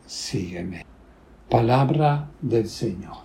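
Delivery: ordinary delivery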